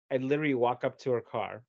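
ordinary talk, nothing marked

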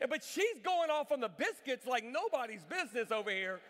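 ordinary delivery

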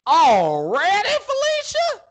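very high, drawn-out Southern country cry; country Southern accent. Retold or imitated. Co-host imitating a friend to Felicia, big country Southern accent. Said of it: "That was my good Southern accent".

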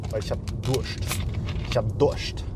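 talking around a sip; words between gulps of a drink; while sipping a drink. Real, not acted. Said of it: "erstmal muss ich was trinken".

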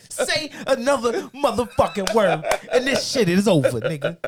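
loud, high threat played for laughs; mock threat. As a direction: threaten loudly as a joke, amid laughter. Mock threat to post it, amid laughter.

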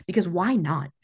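ordinary delivery

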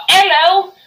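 loud, high imitation English "hello"; mock English accent. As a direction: mimic an English phone greeting, high and loud. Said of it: "he's acting English all the time".

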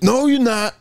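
high, hammed-up challenge; mock confrontation. Retelling a man confronting a stranger, mock confrontation. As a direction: imitate an indignant man contradicting someone. Acting out street confrontation from video.

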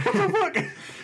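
very high, drawn-out astonishment; mock disbelief. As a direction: stretch it out in a squeaky, laughing disbelief. Retold disbelief at C-SPAN.

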